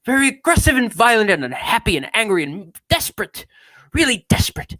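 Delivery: high, theatrical horror-actor recitation; hammed-up affected delivery; Vincent Price. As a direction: build the list up to dramatic desperation. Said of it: "He's like Vincent Price".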